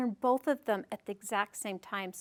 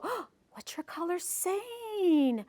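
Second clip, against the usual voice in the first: high, slow, hushed gasp of wonder; parent's playful wonder; 6 semitones higher, 5 dB quieter, slower than usual. Acted. Parent answering a child about colors, playful high wonder. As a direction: coax a child with exaggerated curiosity. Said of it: "You go".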